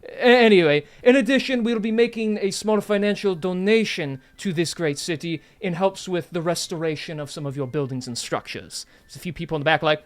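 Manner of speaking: grand lordly host voice; noble host speech. Acted. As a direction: address the crowd like a lord. GM voicing lord's welcome speech.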